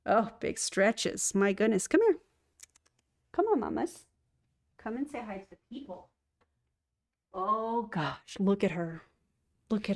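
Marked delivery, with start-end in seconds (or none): 1.9-6.1 high sing-song cat calling; coaxing a pet to come say hi. Real, not acted. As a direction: coax the cat over in a high voice.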